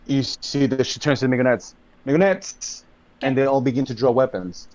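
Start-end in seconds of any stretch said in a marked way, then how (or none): 2.1-2.8 higher, sharp call to the group. Acted. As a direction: call "Migonettes" out as a summons. Nurse calls out to the Migonettes.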